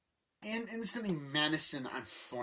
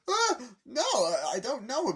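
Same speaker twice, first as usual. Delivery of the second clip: high, loud frightened denial; panicked Luigi; 13 semitones higher, 7 dB louder than usual. Retold or imitated. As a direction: squeal it like a cornered prisoner. Luigi panicking under interrogation.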